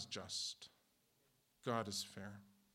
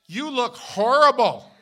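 much higher and louder, slow; mock insult; 12 semitones higher, 23 dB louder, slower than usual. Congregant mocks the pastor's beard, loud slow mock insult. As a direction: drag out the rude verdict loudly.

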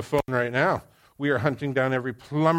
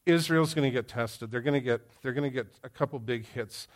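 ordinary talk

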